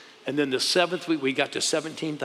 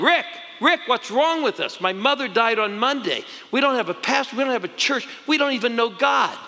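high, louder calling to her husband; retold yell; 9 semitones higher, 4 dB louder than usual. [name] yelling at husband about her mother dying, loud. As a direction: cry out as if yelling again. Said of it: "I yelled at him".

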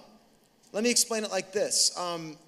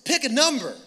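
higher, louder daughter voice; imitated daughter; 5 semitones higher, 5 dB louder than usual. Daughter asks Dad to play, imitated insistent voice. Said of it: "She goes".

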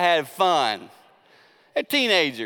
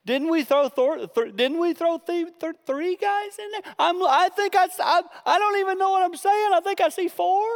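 a baffled king losing count; hammed-up befuddled king; the king, confused; 12 semitones higher than usual. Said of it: "the king turns and says".

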